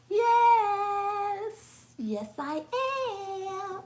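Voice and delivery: high sung-out, stretched affirmation; drawn-out triumphant yes. Acted. Answering a doubting viewer, drawn-out triumphant sing-out. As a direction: sing it out long and high, then laugh it off.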